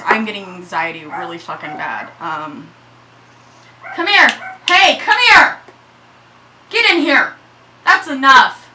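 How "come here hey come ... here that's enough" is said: loud, high scolding yell; mama voice. Real, not acted. Mama to misbehaving dogs, stern yelling. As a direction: order them in like a fed-up mama. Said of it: "I had to use my mama voice".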